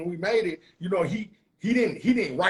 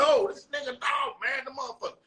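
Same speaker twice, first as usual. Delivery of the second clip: retold shocked outburst; 6 semitones higher than usual. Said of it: "I'm like".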